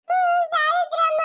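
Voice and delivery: long, high wail of a name; slow, high-pitched tearful moan; crying. Acted. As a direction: wail for her, drawing it out. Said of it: "ಯಾಕಮ್ಮ ಅಳೋದು ನೀನು?".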